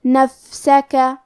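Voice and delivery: نفسکا slowly with a breathy hiss on the silent ف; slow whispered-air ف in نفسکا. Shown as a demonstration. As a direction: let air escape on the ف of نفسکا. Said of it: "تو ہم یہ کلمہ کو ایسے پڑھیں گے".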